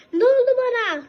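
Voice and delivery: high, loud cry of shock. Real, not acted. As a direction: yelp it in alarm. Turned into ghost suddenly.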